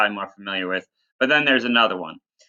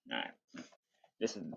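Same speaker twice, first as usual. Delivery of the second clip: ordinary delivery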